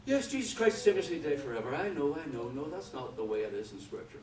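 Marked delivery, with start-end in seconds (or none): 0.0-1.7 mock quick recital, starting high and tailing down; rattled off glibly. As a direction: rhyme it off by rote without thinking. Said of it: "rhyme it off the top of their head".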